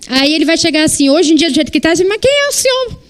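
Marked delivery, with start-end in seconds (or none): 2.0-3.0 high, snippy teenage mock voice; a cheeky son mocking his parent, pitched way up; mocking teen retort.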